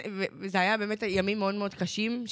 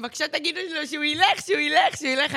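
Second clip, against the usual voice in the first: higher, pleading voice; mock pleading drunk self; 6 semitones higher than usual. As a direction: beg them to send him away. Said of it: "ואני אומרת להם". (תחינה מבוימת)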